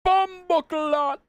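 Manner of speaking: high, drawn-out mock Jamaican exclamation; playful high-pitched Jamaican cry; mock Jamaican shout. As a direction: belt it out slowly in exaggerated Jamaican. Shouts the substitute swear alone.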